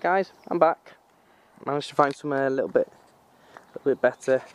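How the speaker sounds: normal talking voice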